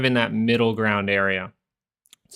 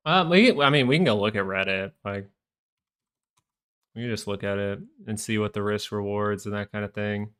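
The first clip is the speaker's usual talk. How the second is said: usual speaking voice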